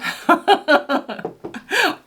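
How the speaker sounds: bright high giggle; high laugh. Real, not acted. Laughs at being addicted.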